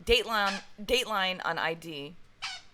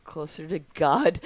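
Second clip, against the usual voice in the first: laughing; 6 dB louder than usual. Real, not acted. Laughing at quoted line.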